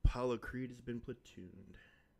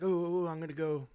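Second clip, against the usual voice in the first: eager, quick and a bit high; hyped self-quote; excited; 5 semitones higher, 5 dB louder than usual. Retold or imitated. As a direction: relive the Monday rush of excitement. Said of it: "I was all excited on Monday".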